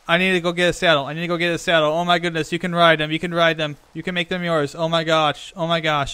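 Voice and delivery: loud, breathless rush of delight; really excited. Real, not acted. Player learning the horse can be ridden, really excited. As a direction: gush loudly, words tumbling over each other. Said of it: "when she gets really excited".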